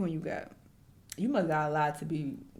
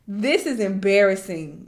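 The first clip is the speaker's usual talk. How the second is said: slow, loud disgust; 10 dB louder than usual. Real, not acted. Reacting to his texts, slow and loud. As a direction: cringe out loud.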